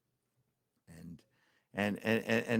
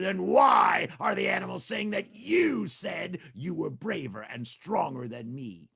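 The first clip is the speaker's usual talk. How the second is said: angry demand; 7 semitones higher, 7 dB louder, slower than usual. Acted. Tiger demands answer.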